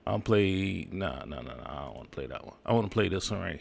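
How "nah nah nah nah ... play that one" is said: quiet, fast aside under his breath; muttering to himself. Real, not acted. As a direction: mumble it to yourself.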